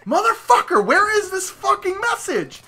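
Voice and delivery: high-pitched exasperated outburst; squealed, over-the-top exasperation; retold frustration. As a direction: wail it in mock fury, pitch shooting way up. Retelling frustration at wrong message.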